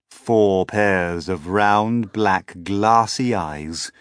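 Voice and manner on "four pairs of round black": ordinary delivery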